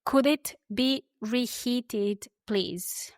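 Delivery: very slow, clear model phrase; said slowly. Shown as a demonstration. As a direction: stretch each word out for learners. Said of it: "I'll say them slowly".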